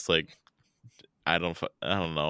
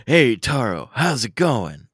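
higher and louder put-on greeting; acted voice-actor greeting; 5 semitones higher, 7 dB louder, slower than usual. Shown as a demonstration. Friend answering the greeting in the podcast bit, loud acted cheer. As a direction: play it up like a cartoon dub. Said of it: "quickly just do that full bit".

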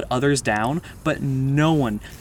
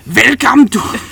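put-on loud, high Austrian accent; 8 semitones higher, 10 dB louder, slower than usual. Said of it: "are we doing the Austrian accent?".

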